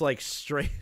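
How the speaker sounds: ordinary talk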